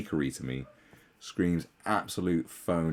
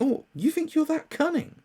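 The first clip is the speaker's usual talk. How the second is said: sarcastic disbelief. Retold or imitated. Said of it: "I think".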